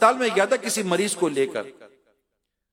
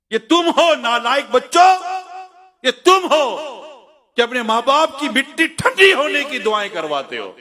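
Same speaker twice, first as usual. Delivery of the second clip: forceful rebuke; 4 semitones higher, 5 dB louder than usual. Real, not acted. Preacher rebukes ungrateful children.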